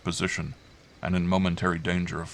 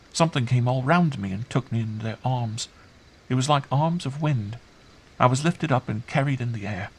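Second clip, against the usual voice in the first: put-on high, lilting child voice; wondering child; 6 semitones higher than usual. Girl recounting being carried off, wondering.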